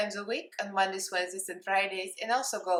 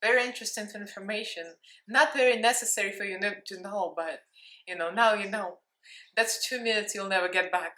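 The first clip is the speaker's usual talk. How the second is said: ordinary delivery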